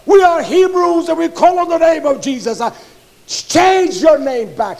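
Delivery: loud, high, fired-up sermon voice; thundering pulpit delivery; full-force preaching. Acted. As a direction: preach it hard to the congregation.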